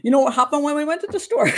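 animated imitation of a Jamaican storyteller; Jamaican accent. Shown as a demonstration. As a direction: build up the tale with a Jamaican lilt. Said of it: "But a Jamaican will say".

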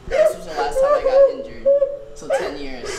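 high cracking laugh-cry. Real, not acted. Son laughing through tears about old injury.

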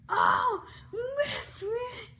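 loud high laughing yell; laughing shout. Real, not acted. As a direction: burst out laughing loudly. Laughing outburst.

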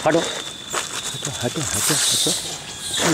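soft, breathy hiss of go away; hushed shooing. Real, not acted. Shooing the greedy cow away from the others, hushed hiss. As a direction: shoo it off under your breath, slowly.

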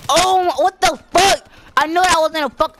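high, loud disbelieving outburst; outraged shouting. Real, not acted. Gamer killed in the match, outraged shouting.

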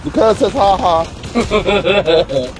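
very slow, stretched laugh words; slow mocking drawl. Real, not acted. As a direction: stretch each word playfully. Drawn out reply.

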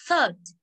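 slow third with a clear th; slow model "third". Shown as a demonstration. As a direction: stretch "third" out slowly to model it. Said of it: "tiếng Anh mình phát là".

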